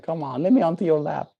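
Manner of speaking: quick pleading dog voice, rising high at the end; playful dog begging, fading soft; voicing the dog. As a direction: beg like an eager dog wanting a lap. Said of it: "This dog is like".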